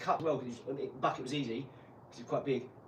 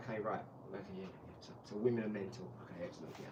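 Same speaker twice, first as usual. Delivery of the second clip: lower, softer deadpan okays; resigned muttering; 7 semitones lower, 9 dB quieter, faster than usual. Retold or imitated. Husband going along with his wife, weary low mutter. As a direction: humour her with weary agreement. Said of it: "I'm like".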